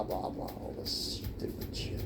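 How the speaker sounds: whispered, annoyed throwaway; muttered aside. Real, not acted. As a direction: whisper it under your breath. Grumbling aside about mom's chatter.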